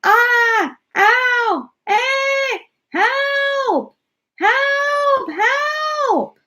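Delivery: shrill panicked screaming and yelling; ghastly screams. Acted. Astronauts over the loudspeaker being attacked, high ghastly screams. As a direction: scream for your life. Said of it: "ghastly screams and yells".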